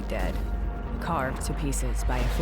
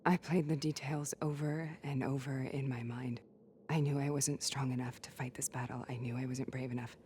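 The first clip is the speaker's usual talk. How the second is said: whispering; 6 dB quieter than usual. Acted. Quiet inner reflection, whispered.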